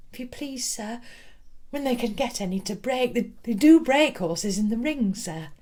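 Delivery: a shy pupil speaking up; young girl addressing her teacher; timid schoolgirl. Acted. Nervous schoolgirl explains.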